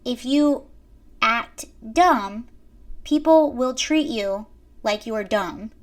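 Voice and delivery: ordinary talk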